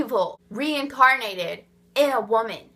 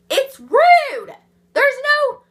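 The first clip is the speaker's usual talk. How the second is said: much higher, louder burst of exasperation; exasperated outburst; 13 semitones higher, 9 dB louder, slower than usual. Real, not acted. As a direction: blurt it out, fed up and indignant. Ranting, peak of frustration.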